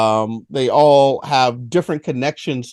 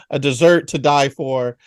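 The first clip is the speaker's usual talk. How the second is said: ordinary talk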